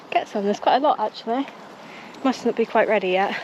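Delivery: usual speaking voice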